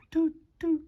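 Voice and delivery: high whistle-like doot doot; train horn toot. Acted. Reading a train book to a child, high train toot.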